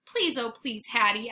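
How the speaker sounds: ordinary delivery, nothing marked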